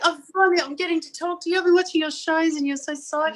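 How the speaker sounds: high, gushing fan voice; eager, high-pitched gushing; acted fan excitement. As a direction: re-enact being thrilled to finally get through. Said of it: "so I'm like this".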